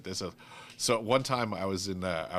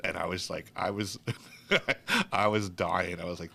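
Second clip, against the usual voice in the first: ordinary talk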